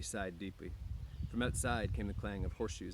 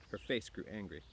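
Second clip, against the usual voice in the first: usual speaking voice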